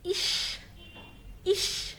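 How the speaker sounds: إش with a soft, light "sh"; quiet إش, CH as a light hiss; light sheen. Shown as a demonstration. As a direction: say إش gently as "ish". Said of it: "شين خفيفة".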